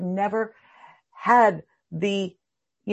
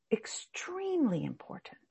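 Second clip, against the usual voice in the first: whisper; 4 semitones higher, 10 dB quieter than usual. Real, not acted. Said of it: "stressing importance to class".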